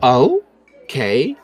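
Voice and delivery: uneasy, edging away from the dogs; startled and polite; nervous dwarf. Acted.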